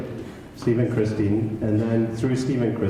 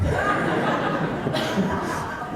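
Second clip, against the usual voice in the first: breathy laugh; 11 semitones higher than usual. Real, not acted. Laughing at his own impression.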